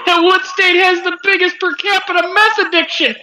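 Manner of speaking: mock comeback. Friend suggesting a comeback to the store guy, mock taunt. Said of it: "you shoulda come back with".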